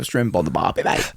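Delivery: put-on Australian accent. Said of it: "What about Australian?".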